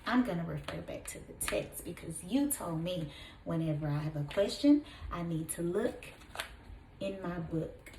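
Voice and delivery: soft, fast teacher aside; quiet aside. Real, not acted. Teacher quietly explaining to pupils why she checks the book. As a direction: drop to a confiding murmur.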